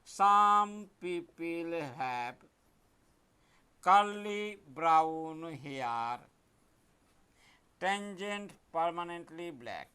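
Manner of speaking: very slow, stretched words while writing; slow dictation. Shown as a demonstration. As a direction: drag each word out as you write it. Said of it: "দেখো আমি কেমন করে লিখছি". (লিখতে লিখতে খুব ধীরে বলা)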